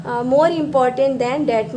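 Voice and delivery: very slow, every word laid out; slow model sentence. Shown as a demonstration. Teacher to students, slowly modelling the comparative. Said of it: "ऐसे कहते हैं".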